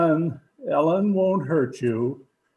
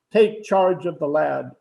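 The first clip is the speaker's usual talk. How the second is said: ordinary talk, nothing marked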